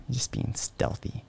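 hushed whisper; a stealthy hush about the hiding puppy. Real, not acted. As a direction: whisper it like a secret. Whispered joke.

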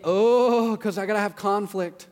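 loud, high drawn-out "oh"; mock dread. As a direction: groan out an imagined worrier's reluctance. Voicing listener's dread of conflict.

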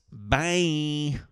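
high, cheeky brush-off; dismissive bye. Pretend. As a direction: wave them off with a high, snotty bye. Dismissing viewers who don't know.

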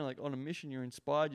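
normal talking voice

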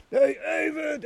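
sharp high call across the yard; high urgent call for a friend. Retold or imitated. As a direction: shout his name to warn him. Calling out to warn friend.

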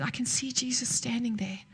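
quiet whisper, awed at seeing Jesus; hushed awe. Retold or imitated. Young woman at prayer line, whispering in awe. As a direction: breathe it softly in awe. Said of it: "She just went all quiet".

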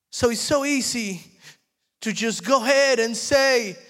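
very high, loud, fervent storytelling sermon; preaching at full force. Real, not acted. Preacher acts out Jesus and Mary for the church, high and loud. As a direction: act out the gospel scene at full force.

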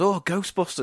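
an excited "oh" at a new release; mock excitement. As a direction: imitate a thrilled cinema-goer. Excited retold cinema reactions.

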